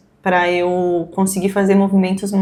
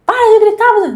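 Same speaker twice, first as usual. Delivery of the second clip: mock yelling back; 12 semitones higher, 5 dB louder, much faster than usual. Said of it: "a gente não grita junto".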